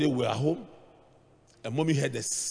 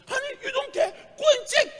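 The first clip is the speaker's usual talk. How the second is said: panicked cry. Retold or imitated. Wife panicking at robbers.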